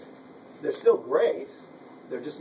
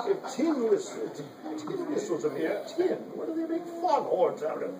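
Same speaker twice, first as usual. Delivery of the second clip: put-on Cockney accent; 11 semitones higher than usual. Repeating Donegan's joke in a light Cockney accent. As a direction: retell the joke in a hint of Cockney. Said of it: "like in a Cockney accent".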